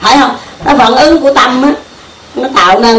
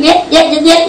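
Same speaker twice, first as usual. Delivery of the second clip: mock agreeing; 4 semitones higher than usual. Shown as a demonstration. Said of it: "nó nói gì cũng nói".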